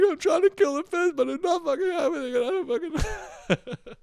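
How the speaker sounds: high wailing mock sob; imitating crying. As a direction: wail it like a kid bawling over a fish. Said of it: "And then I start to cry".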